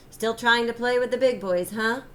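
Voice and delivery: loud mocking jeer; taunting. A narrator's reading. Mark taunting Michael on the court, loud and mocking. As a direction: sneer it at the little kid. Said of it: "Mark taunted".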